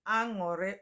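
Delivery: Aṅụrị said with an L, "ang-o-leh"; dialect variant. Shown as a demonstration. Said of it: "your intonation and dialect".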